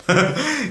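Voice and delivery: quick bright burst of laughter; a real little laugh; short laugh. Real, not acted. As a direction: laugh at yourself, high. Laughs at his car enthusiasm.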